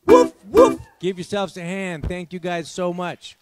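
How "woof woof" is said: very high, very loud woof woof; big finish barks. Pretend. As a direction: bark it out as the grand finale. Song verse ends on dog.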